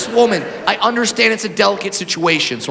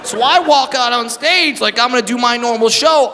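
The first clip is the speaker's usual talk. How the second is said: ordinary talk, nothing marked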